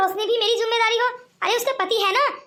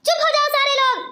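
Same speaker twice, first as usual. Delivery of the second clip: angry shout; 4 semitones higher, 3 dB louder, faster than usual. Acted. Angry friend silences party crowd.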